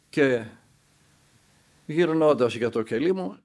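ordinary delivery

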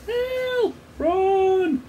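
very high, thin mock screams; distant screaming. A narrator's reading. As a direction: imitate a panicked crowd far away. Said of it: "people screaming and shouting".

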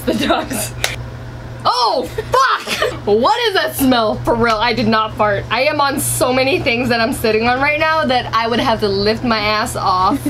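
usual speaking voice

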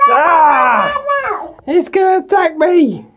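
mock terror. Toy figure screaming as the trooper attacks, hammed-up. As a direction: shriek like a toy about to be stomped.

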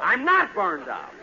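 slow, touchy, insisting he isn't; annoyed denial. Acted. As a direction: deny it through clenched pride. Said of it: "no reason for you to get so upset".

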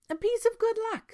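high, loud incredulous echo; astonished king. A narrator's reading. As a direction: repeat it in royal disbelief. King echoes news in disbelief.

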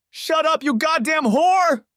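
wailing rage, drawn out; furious wail. Acted. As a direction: scream at the rival. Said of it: "Judy wailed".

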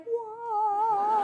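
high held "whoa", almost sung; drawn-out whoa. Retold or imitated. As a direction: retell being awestruck with a long whoa. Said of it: "I'm like".

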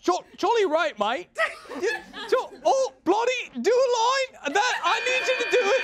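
high, hammed-up British accent; mock British accent. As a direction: play a cheeky British bloke.

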